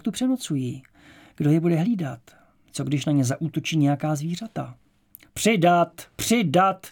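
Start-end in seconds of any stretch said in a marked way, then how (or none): 5.3-6.9 high, loud repeated order; shouted command. A narrator's reading. Guards drive the slowing column forward, shouted command. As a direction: shout it down the line to hurry them. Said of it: "zněly povely odzadu dopředu".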